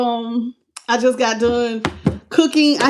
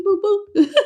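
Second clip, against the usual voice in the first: teasing laugh; 7 semitones higher than usual. Real, not acted.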